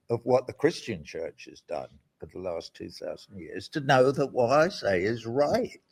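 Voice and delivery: usual speaking voice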